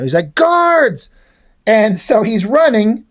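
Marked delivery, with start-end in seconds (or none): 0.3-1.1 high, drawn-out mock call; calling for guards. Fleeing villain summoning his guards, calling out. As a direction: call out for the guards in a raised pitch.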